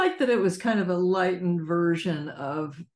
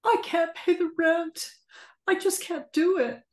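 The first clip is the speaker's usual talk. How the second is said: high, pleading distressed widow; helpless victim voice; 10 semitones higher than usual. Shown as a demonstration. Savannah pleads.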